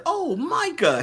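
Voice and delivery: high chirpy robot voice; little robot saying a name. Retold or imitated. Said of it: "the little robot says".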